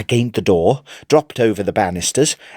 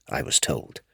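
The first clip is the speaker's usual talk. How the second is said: hushed aside; 8 semitones lower, 10 dB quieter than usual. A narrator's reading. Sinister aside after the message.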